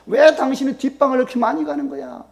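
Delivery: high-pitched accusing wife; jealous nagging. Acted. Jealous wife confronting her husband.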